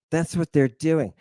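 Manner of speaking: ordinary delivery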